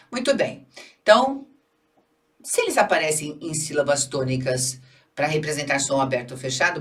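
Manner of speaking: ordinary talk, nothing marked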